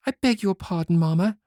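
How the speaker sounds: put-on humble girl begging pardon; meek young girl. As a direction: apologise sweetly as the good daughter. Good girl answers her mother.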